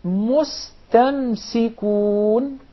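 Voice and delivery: mustamsikun recited very slowly; slow syllable-by-syllable mustamsikun; two clear sins. Shown as a demonstration. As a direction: draw out mustamsikun, each S clear. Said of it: "there are two scenes in this word".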